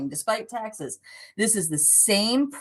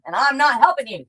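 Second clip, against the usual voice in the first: firm refusal, higher and louder; putting her foot down; 5 semitones higher, 5 dB louder than usual. Real, not acted. Shop host warns late buyers she won't help, firm. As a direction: lay down the law, raised voice. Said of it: "She put her foot down".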